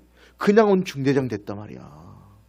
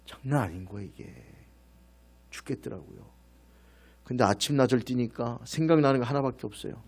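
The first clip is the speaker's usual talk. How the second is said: ordinary delivery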